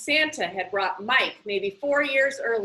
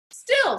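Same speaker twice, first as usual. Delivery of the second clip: high, louder Still pushed out; insistent; 12 semitones higher, 5 dB louder than usual. Acted. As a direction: cut in with a firm objection. Curtis defends library.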